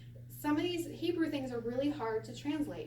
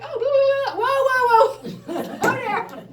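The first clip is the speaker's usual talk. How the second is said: very high, loud burst of gibberish and whoas; mock alarmed interruption; 12 semitones higher, 13 dB louder than usual. Israelis halting a learner's Hebrew, mock alarmed whoa. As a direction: cut in high and loud, half laughing. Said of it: "and they're like".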